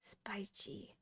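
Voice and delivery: soft unvoiced echo of the word; whispering. Real, not acted. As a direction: whisper it back to yourself.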